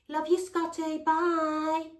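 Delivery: high, drawn-out singsong sign-off; put-on high goodbye voice; mimicked phone goodbye. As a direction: mockingly imitate a woman's sweet phone farewell.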